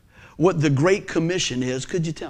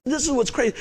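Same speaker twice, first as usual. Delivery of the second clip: ordinary talk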